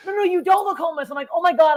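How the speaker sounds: high-pitched flustered denial; retold frantic backpedalling. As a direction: backpedal in comic panic.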